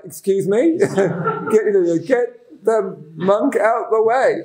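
slow, high mock huffiness; mock indignant. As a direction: play the devas as fussy and put out. Devas complaining, mock voices.

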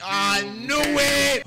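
high, loud, drawn-out cry; vindicated outburst. Real, not acted. Viewer seeing his prediction come true, long excited cry.

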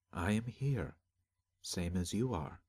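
lightly voiced calm spirit, unhurried; disembodied voice. A narrator's reading. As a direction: answer quietly as an unseen guide.

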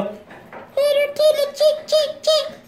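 high squeaky chick call; calling chickens. Pretend.

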